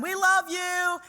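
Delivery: high, loud, joyful holler; elated calling out. Retold or imitated. Neighbors shouting on a celebratory phone call, elated and affectionate.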